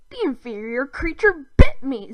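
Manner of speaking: a touch of hissed alien disdain; lightly voiced indignant alien; alien invader voice. A narrator's reading. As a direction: spit it out as an offended alien. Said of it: "Zim hissed".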